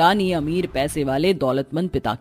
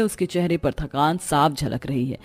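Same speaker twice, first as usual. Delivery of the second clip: plain reading voice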